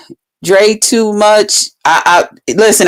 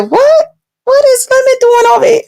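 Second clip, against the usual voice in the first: shocked surprise. Retold or imitated. Sees him on panel.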